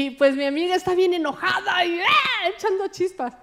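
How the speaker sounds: normal talking voice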